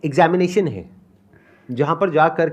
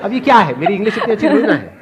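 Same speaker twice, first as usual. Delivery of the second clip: laughing; 3 dB louder than usual. Real, not acted. Laughing at his crammed line.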